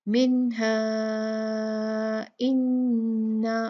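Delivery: slow recited इन्ना, vowel held long; four-count madd. Shown as a demonstration. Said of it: "चार count करेंगे". (इन्ना को चार हरकत खींचकर)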